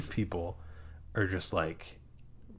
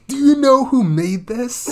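much higher and louder, bursting with pride; hammed-up proud shout; 14 semitones higher, 12 dB louder than usual. Man shouting proudly to his girlfriend about the jerky, hammed up. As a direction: crow it like a proud salesman.

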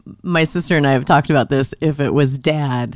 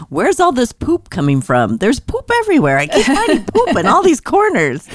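high-pitched, hurried mock outcry; mock exasperated complaint; 8 semitones higher than usual. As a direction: wail it out, climbing high as you go. Voices parent's recurring complaint, hammed up.

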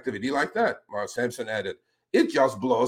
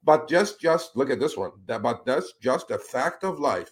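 usual speaking voice

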